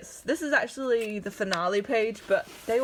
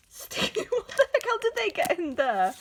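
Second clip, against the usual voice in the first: high, loud startled squeal; real surprise; 10 semitones higher, 3 dB louder than usual. Real, not acted. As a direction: yelp as if something jumped out. Finds unexpected sticker in book.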